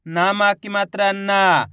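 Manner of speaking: slow chant ending on a long ना; drawn-out reading. Shown as a demonstration. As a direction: stretch the final ना with a held aa. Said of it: "तो खींच के पढ़ेंगे".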